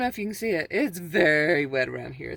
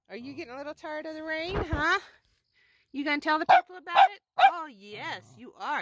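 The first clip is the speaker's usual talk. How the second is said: higher and fast coaxing voice for the dog; 8 semitones higher than usual. Real, not acted. Owner teasing her tired dog, high and fast baby talk. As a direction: tease the dog in a sing-song.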